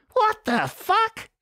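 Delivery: very high, drawn-out shocked blurt. A narrator's reading. Ferret hearing he must go to Japan, blurting in shock. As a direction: let it burst out slowly in disbelief. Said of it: "he blurted".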